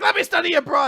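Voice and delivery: high, loud mock begging yell; desperate plea. Recapper acts the girl kowtowing to parents, desperate shout. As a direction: beg at the top of your voice, hammed-up.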